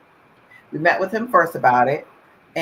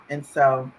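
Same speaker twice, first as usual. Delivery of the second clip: ordinary talk, nothing marked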